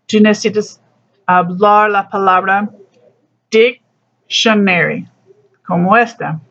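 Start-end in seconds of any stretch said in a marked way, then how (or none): 3.5-5.1 "dictionary" with the weight on DIC, said slowly; teacher modelling the stressed DIC in "dictionary"; stress on first syllable. Shown as a demonstration. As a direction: punch the first part of "dictionary", DIC-tionary. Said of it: "Es más fuerza en la primera parte".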